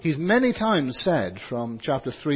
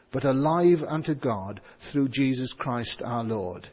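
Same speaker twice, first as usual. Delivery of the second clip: ordinary delivery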